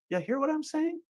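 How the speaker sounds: a silly, high, hushed ya hear; high, soft put-on voice. Host teasing viewers with a high, soft question. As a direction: squeak it out softly and high.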